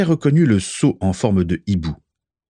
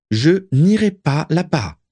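higher, slow, defiant; defiant refusal; 8 semitones higher, 3 dB louder, slower than usual. A narrator's reading. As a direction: refuse each word, rebelling. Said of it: "se révolta Narcissa". (révoltée, lente et plus aiguë)

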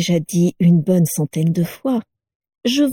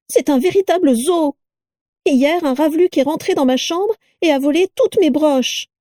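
higher mocking wife's voice; mock the new parcel, voice pitched up; shrill sneering Carla; 9 semitones higher than usual. A narrator's reading.

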